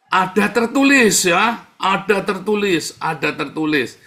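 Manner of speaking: loud, high, drawn-out mock quotation; imitating the devil's claim. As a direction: proclaim it slowly and high, fading by the last repeat. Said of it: "katanya setan ya kan".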